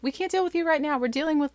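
mock flustered parent, hands full; retold frazzled parent. Parents to oldest child, frazzled retold brush-off.

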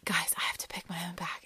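whispered, rushed retold plea; urgent whisper to the group; hushed plea. Storyteller begging friends before her turn, hushed plea.

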